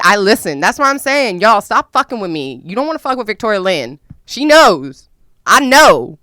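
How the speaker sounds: loud, high defiant warning. Real, not acted. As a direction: warn them off, loudly.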